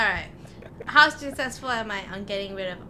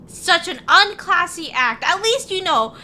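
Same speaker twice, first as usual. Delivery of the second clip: higher, louder, slow scolding mother; mom lecture; 8 semitones higher, 6 dB louder, slower than usual. Acted. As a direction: scold them like an outraged mom. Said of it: "I'm scolding the mage hands, be like".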